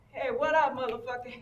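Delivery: loud, high, swaggering street hello; cocky greeting. Retold or imitated. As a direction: call it out big across the parking lot. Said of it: "So I walk across the parking lot and I say".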